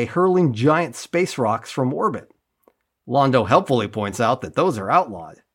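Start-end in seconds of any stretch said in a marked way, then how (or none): none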